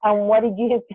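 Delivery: ordinary talk, nothing marked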